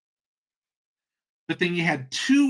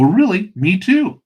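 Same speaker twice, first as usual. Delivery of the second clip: loud, high, hammed-up delight; mock excited agreement; 5 semitones higher, 8 dB louder, slower than usual. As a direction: act thrilled at the shared faith. Joke's delighted common-ground reply, escalating.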